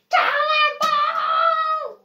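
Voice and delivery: long, high shouted cannonball; cannonball yell. Pretend. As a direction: yell it while leaping in. Doll jumps into the pool.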